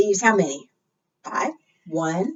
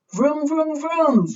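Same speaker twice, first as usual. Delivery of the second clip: engine vroom; 8 semitones higher, slower than usual. Pretend. Imitating car engine for children.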